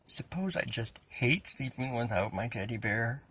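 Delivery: usual speaking voice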